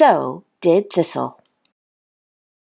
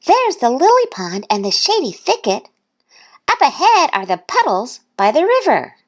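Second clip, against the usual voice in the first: shouting out; 9 semitones higher than usual. A narrator's reading. Duck showing his new friend around, high calling voice. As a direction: call out favorite spots in a high voice. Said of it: "he shouted out all his".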